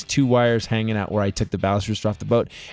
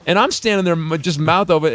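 usual speaking voice

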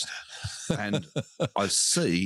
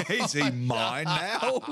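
high, slow kid's question, acted; wondering child; 8 semitones higher, much slower than usual. Comic as a child staring at the dwarf, hopeful.

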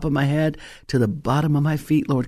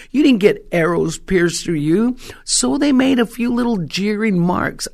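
ordinary talk, nothing marked

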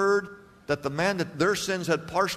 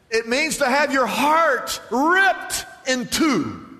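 emphatic preaching cry; 5 semitones higher, slower than usual. Real, not acted. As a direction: drive the point home slowly.

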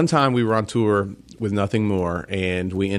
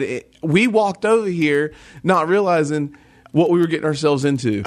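put-on thick South Carolina accent; 6 semitones higher than usual. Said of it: "I lay it on thick".

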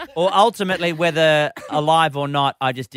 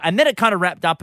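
ordinary talk

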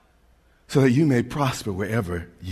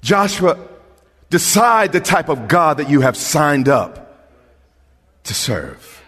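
normal talking voice

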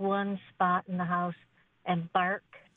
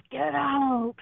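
loud, higher growling ghost command; growly voice; 6 semitones higher, 5 dB louder than usual. Retold or imitated. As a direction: bark it like an angry ghost. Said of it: "growly voice says".

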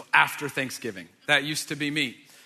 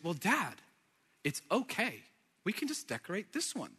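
put-on soft, halting small-child voice; little three-year-old daughter; 9 dB quieter than usual. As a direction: play a sweet small child gently consoling Dad. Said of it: "my little three-year-old".

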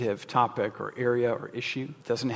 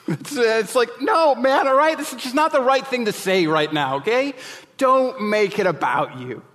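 much higher and louder, pleading no man; exasperated outburst; 9 semitones higher, 5 dB louder than usual. Real, not acted. Preacher to the congregation, reacting to Elkanah, loud exasperated protest. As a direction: cry out at him to stop making it about himself.

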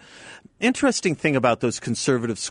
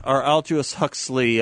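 usual speaking voice

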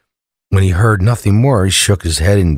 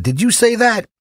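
higher-pitched acted question; lightly voiced startled burglar; 13 semitones higher than usual. Joke teller as burglar to parrot, raised questioning voice. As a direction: ask it high, as a lightly played burglar.

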